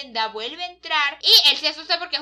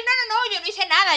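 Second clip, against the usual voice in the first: higher, louder flustered denial; protesting denial; 6 semitones higher, 5 dB louder than usual. Acted. Friend denies doing anything last night, flustered protest. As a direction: deny it emphatically, pitch jumping up.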